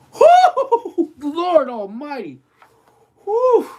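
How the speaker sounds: high whooping exclamations; overwhelmed whooping. Real, not acted. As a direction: whoop it out in shock. Reacting after eating.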